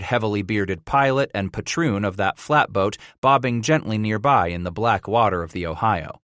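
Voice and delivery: plain reading voice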